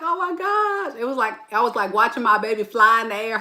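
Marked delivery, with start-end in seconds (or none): 0.0-0.9 high-pitched gasp of awe. Retold or imitated.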